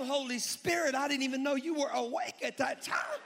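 high, loud mock astonishment; inward disbelief. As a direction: holler it as if utterly baffled. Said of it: "But on the inside, I was like".

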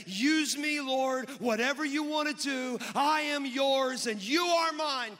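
loud, high, drawn-out cry to God; fervent surrender. Acted. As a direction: belt out the prayer with fervour. Preacher voices a surrender prayer.

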